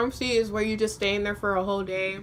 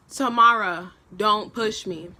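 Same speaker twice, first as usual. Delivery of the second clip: louder, emphatic warning on the name; firm warning; 5 dB louder, slower than usual. Retold or imitated. Girl warns her play-fighting friend, firm and louder. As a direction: call the name out firmly.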